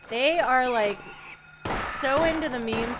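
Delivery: loud gushing; hyped up, loud and fast; excited. Real, not acted. Excited gush about Natty Light.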